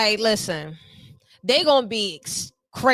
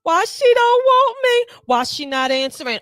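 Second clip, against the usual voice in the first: crying; 10 semitones higher, 4 dB louder than usual. Retold or imitated. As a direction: whimper like you were dumped, hammed up. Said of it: "have them crying like".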